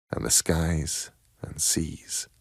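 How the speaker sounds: soft whispered close, slow; whispered ending. A narrator's reading. Narrator closing the tale, soft slow whisper. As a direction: let the last words fall to a whisper.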